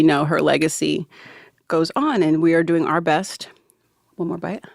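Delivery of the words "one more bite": whispering. Real, not acted. Eating peanut butter on air.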